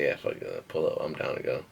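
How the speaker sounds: low, soft, careless mumble; just shrug it off low and soft; lazy drunk yes. Retold or imitated. Agreeing to keep drinking, a low soft mumble.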